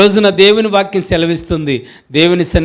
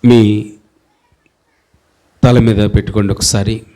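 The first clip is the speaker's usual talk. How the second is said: lower, hushed prayer voice with long pauses; leading prayer; 5 semitones lower than usual. Acted. Leads congregation in hands-on prayer. (తగ్గిన స్వరంతో నెమ్మదిగా ప్రార్థన)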